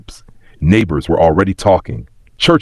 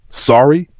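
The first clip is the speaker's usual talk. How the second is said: high, rising question; incredulous echo; 7 semitones higher than usual. A narrator's reading. Betrayed sister echoing the guilty one's apology, incredulous. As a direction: throw the word back in disbelief.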